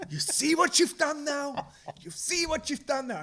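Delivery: high, loud hammed-up blame; exasperated yelling. Dad blaming wife for the screaming kids, hammed-up yell.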